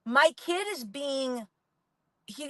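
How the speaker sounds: ordinary talk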